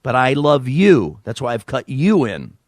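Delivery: ordinary talk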